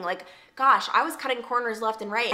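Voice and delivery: ordinary talk, nothing marked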